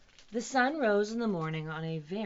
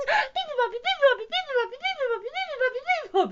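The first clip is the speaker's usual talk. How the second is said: very high, loud birdsong chatter; squeaky rapid-fire chirping; chirping little bird; 12 dB louder than usual. A narrator's reading. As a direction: pipe it out like a tiny tireless bird. Bird bursts into its song.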